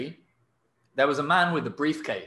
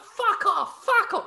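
much higher, louder frantic yelling; crazy shouting; 4 dB louder, slower than usual. Retold or imitated. Mum pushing the man off the tube, shrieking wildly. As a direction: shove him out screaming. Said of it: "really crazy".

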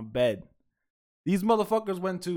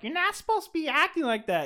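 much higher put-on voice; scolding old people; 12 semitones higher than usual. Host mimicking old wine tasters scolding pranksters, high voice. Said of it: "all these old people are like".

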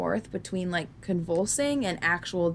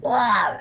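startled blurt; 5 semitones higher, 5 dB louder than usual. Retold or imitated. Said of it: "And then I was like".

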